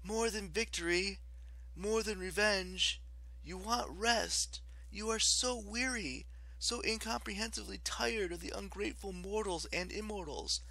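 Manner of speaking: high, gentle, hypnotic coaxing; soothing. A narrator's reading. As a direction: lull the goddess toward rest. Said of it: "Piper said soothingly".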